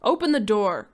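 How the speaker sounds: loud commanding shout; father shouting through the locked door; crying out. Acted. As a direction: shout it as you try the knob. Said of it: "cried George Hadley".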